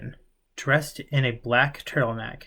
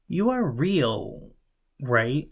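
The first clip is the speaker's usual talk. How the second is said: hesitant stammer; 2 semitones higher, much slower than usual. Acted. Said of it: "he stammered as he tried".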